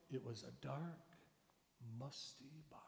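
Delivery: dark, musty barn whispered softly; hushed storytelling. Shown as a demonstration. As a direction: draw the kids in with a hushed whisper. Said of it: "you just say".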